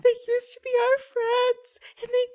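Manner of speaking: very high little-kid voice, breaking into acted wails; bawling child. Child telling a stranger about the scary man, bawling child voice. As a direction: stammer it out like a small child in tears. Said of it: "he's now bawling inconsolably".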